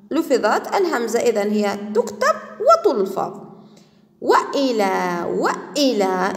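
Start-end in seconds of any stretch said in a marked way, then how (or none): 4.2-6.3 slow, drawn-out وإلى with glottal stop after wa; hamza pronounced. Shown as a demonstration. Teacher has pupils attend to cutting hamza, hamza pronounced. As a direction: keep the hamza audible in وإلى, "wa-'ilā". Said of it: "انتبه إلى اللفظ".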